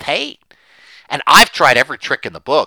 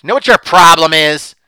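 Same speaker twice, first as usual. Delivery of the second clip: acted husband's outburst; 7 semitones higher, 10 dB louder than usual. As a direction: hurl it at her, hammering the last words. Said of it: "he's gonna tell them what her problem is".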